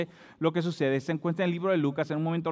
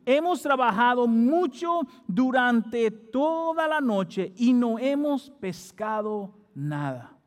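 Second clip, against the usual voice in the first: very slow, higher, stretching "toda la noche"; emphatic scripture reading; 6 semitones higher, slower than usual. Acted. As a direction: read it word by word, leaning on "mucho" and "toda". Reads Simon's weary complaint emphatically. (lectura lenta y enfática, alargando "toda")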